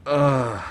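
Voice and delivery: loud, disgusted "ugh"; exasperated groan. Acted. Villain reacting to Rowan's blunder, exasperated groan. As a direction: groan in frustration.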